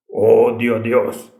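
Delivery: slow, drawn-out demon like a choir bass; very deep demon voice. Retold or imitated. Demon speaking through a possessed girl, deep slow bass voice. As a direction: imitate a deep, smoky possessing voice, stretched out. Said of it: "la otra voz muy profunda, como humo, como un bajo en el coro".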